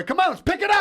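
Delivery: shouted high and loud, hamming up a coach; mock coach shout. Co-host playing a coach rallying players, mock shout. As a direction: yell it like a coach firing up the bench.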